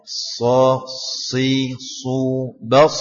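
heavy s, tongue pressed up; emphatic s. Shown as a demonstration. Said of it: "Harus diangkat lidahnya". (s tebal lidah terangkat)

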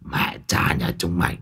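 low, soft hissed curse. A narrator's reading. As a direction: hiss it through rage. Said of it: "Lão rít lên". (rít lên giận dữ)

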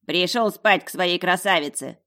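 loud, baring-the-teeth taunt; snarling talking dog. A narrator's reading. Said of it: "оскалился пёс". (оскалившийся наглый пёс)

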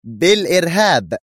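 high, emphatic exclamation; mild shout, pitched sharply up; shouting back. A narrator's reading. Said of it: "صاحت بدورها".

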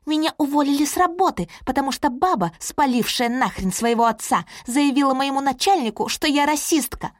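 high, fast furious rant; angry shout. Acted. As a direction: yell it in her face, high and fast. Кэролайн орёт в кафе. (Кэролайн кричит на рассказчицу: высокий, быстрый яростный крик)

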